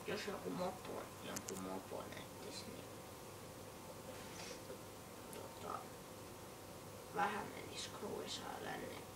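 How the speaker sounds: voiceless, hushed words; whisper. Real, not acted. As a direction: whisper it under your breath. Streamer whispers while playing.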